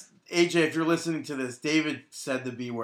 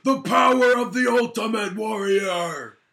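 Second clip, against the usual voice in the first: higher, louder shouted wrestler promo; Ultimate Warrior; 7 semitones higher, 5 dB louder than usual. Shown as a demonstration. Showing the co-host his Warrior voice, shouting. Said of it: "Is that a good, uh, impression?".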